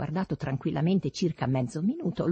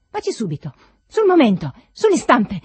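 high, loud, mounting commands; shouting; 6 semitones higher, 11 dB louder than usual. A narrator's reading. Master dares servant to go denounce him, shouting. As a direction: shout each order harder. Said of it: "le mie grida di richiamo".

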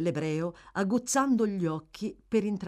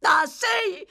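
high, loud bitter retort; sharp, wounded shout; bitter cry; 8 dB louder than usual. A narrator's reading. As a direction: fling it back at him bitterly. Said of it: "gridò la ragazza amaramente".

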